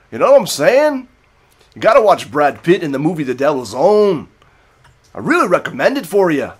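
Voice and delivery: exaggerated sing-song Irish lilt, swooping up and down; Brad Pitt's Irish accent. Shown as a demonstration. Film fan demonstrating the movie's accent, in sing-song mock Irish. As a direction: recommend it in a hammed-up Irish brogue. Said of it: "that sing-songy, but as Brad Pitt used".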